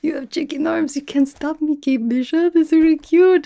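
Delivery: taunting her in a sing-song mock voice. To Kate Bishop in the fight, a high mocking taunt. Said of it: "I was like".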